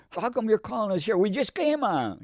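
high-pitched imitation of a gripe; mock complaining. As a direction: act out a complainer's high-voiced moan. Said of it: "we never complained about it".

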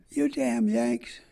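mild mock holler. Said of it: "this lady was hollering".

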